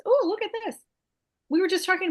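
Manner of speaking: surprised delight. Real, not acted. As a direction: gasp at what you see. Surprised at the screen.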